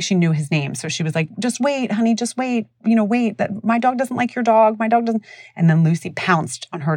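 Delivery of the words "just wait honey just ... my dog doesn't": retold high-pitched pleading, cut off mid-thought; imitated anxious plea. Neighbor holds off boy with aggressive dog, imitated high plea. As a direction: imitate a neighbor urgently stalling a child.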